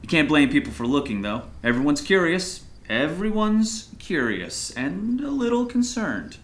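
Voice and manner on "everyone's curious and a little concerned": slow, higher-pitched, stretched phrasing; drawn-out emphasis. Acted. Pastor hinting at the town's concern, drawn out. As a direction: draw out "curious" and lift the pitch.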